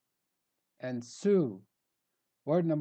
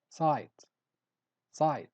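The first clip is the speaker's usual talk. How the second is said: normal talking voice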